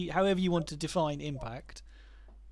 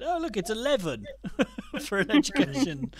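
laughing; 6 semitones higher than usual. Real, not acted. Mock calculation ends at eleven.

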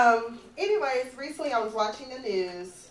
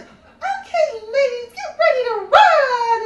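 much higher, louder bootcamp coach call; hammed-up trainer; 13 semitones higher, 13 dB louder, slower than usual. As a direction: bark it like a peppy drill instructor. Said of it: "the lady's like".

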